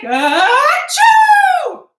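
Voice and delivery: loud, high shouted achoo; big fake sneeze. Teacher acting the ant's sneeze for the children, loud and hammed-up. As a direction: blast out a huge pretend sneeze. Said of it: "Oh, that was a big sneeze".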